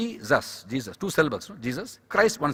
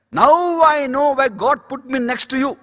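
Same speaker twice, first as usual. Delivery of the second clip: loud, high, drawn-out declaration; emphatic realization; 9 semitones higher, 7 dB louder, slower than usual. Retold or imitated. Preacher retelling why he was seated there, emphatic realization. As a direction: proclaim it slowly and loudly.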